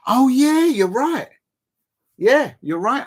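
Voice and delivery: surprised agreement. Real, not acted. Reader's comment corrects him.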